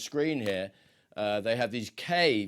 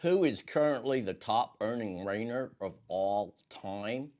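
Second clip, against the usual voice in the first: Western accent. Shown as a demonstration. Quizmaster reading out to viewers in a Western accent. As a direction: quiz question in a Western accent, slightly different timbre. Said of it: "maybe I should do this in my Western accent".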